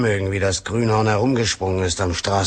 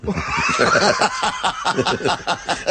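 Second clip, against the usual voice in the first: laughing; 8 semitones higher than usual. Acted. Laughing at the phone voice.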